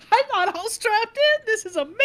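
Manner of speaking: put-on high, fast, breathless little girl voice; excited little girl. Granddaughter to grandma about the car seat, high excited child voice. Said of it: "looking around like".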